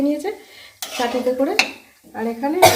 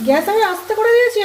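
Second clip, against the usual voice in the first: much higher and louder, protesting; heated insisting; 10 semitones higher, 8 dB louder than usual. Real, not acted. Mother arguing with daughter about the gas, loud insistence.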